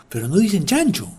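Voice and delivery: ordinary talk, nothing marked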